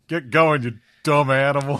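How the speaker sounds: hammed-up chasing off an animal; mock shooing shout. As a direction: shout it to drive a beast away. Acts out driving an animal away.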